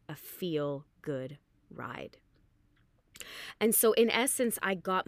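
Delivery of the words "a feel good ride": slow, soft, near-whispered reverence; hushed savoring. Real, not acted. Rider recalling the magical class, hushed and slow. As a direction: drop to a slow hush, savoring each word.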